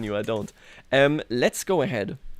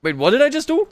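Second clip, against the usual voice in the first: much higher and louder, real alarm; shocked; 12 semitones higher, 8 dB louder than usual. Real, not acted. Player seeing the map change, shocked outburst. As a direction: suddenly realise the blunder, voice jumping up. Notices a game mistake.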